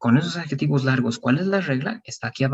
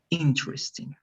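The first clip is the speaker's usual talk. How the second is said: Interesting with weight on IN; stress on first part; 6 dB quieter than usual. Shown as a demonstration. Teacher models the word for the student, stress first. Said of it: "interesting en la primera parte".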